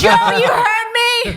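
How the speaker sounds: high, loud laughing shout; laughing call-out. Real, not acted. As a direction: laugh it out at him. Laughing call-out to Joe Starr.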